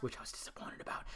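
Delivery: hushed conspiratorial aside; whisper. Real, not acted. Whispering a cheeky aside to viewers. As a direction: drop to a whisper for the naughty bit. Mock-secret aside about nudity.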